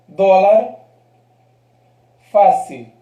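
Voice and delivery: "Dólar, fácil" each strong on the first syllable; penultimate stress. Shown as a demonstration. Teacher listing grave examples, first syllable strong in "Dólar, fácil". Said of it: "penúltima sílaba, fuerte".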